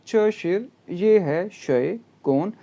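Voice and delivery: ordinary talk, nothing marked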